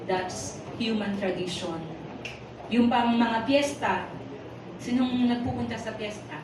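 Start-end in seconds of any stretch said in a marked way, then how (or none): none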